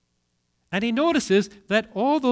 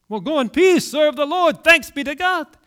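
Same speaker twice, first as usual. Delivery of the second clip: loud, higher liturgical send-off; rousing dismissal; 5 semitones higher, 6 dB louder than usual. Acted. Sending the congregation out at the end, loud and rousing. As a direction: call out the dismissal loudly.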